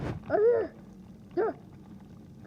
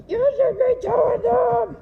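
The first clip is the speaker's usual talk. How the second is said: higher, louder, slow demand; insistent shout; 9 semitones higher, 9 dB louder than usual. Real, not acted. Kid shouting at the sibling to tell the viewers, insistent. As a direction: insist loudly he does it.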